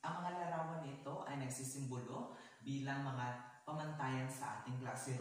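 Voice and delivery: normal talking voice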